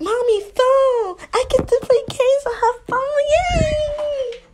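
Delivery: high, gleeful squeal building to a drawn-out yay; thrilled little-girl cheer; excited little kid. Acted. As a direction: squeal with childish delight. Child delighted to find the phone.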